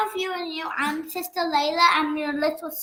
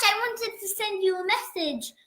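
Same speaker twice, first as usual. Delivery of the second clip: normal talking voice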